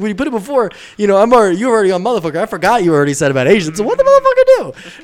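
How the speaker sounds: high, loud stand-up rant; imitated comedian's rant. Retelling a comedian's bit, ranting incredulously. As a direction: ramp up to a mock yell. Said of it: "He's like".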